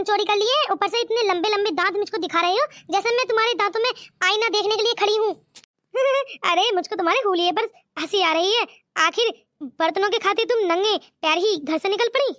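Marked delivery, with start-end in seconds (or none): 5.9-6.4 a short mocking laugh at her; amused chuckle at her state. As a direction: laugh at how she looks. Man laughs at her bare feet.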